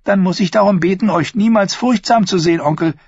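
Thyene's voice. Acted. Thyene taunting her uncle the prince, mildly mocking. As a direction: needle your uncle with sweet sarcasm.

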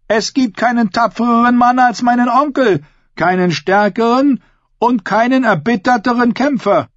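high, projected mock reassurance; ringing, high-pitched public speech; bold woman addressing assembly. A narrator's reading. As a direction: tease your uncle before a crowd.

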